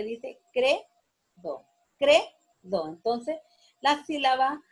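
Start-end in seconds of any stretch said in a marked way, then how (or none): 0.5-1.6 credo broken up, CRE held long then do; penultimate stress. Shown as a demonstration. Teacher proving credo is grave, slow stress on CRE. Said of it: "es una palabra grave porque". 2.0-2.9 credo with CRE punched loud, do falling away; penultimate stress. Shown as a demonstration. Teacher repeating the grave word, credo stressed on CRE. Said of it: "es una palabra grave porque".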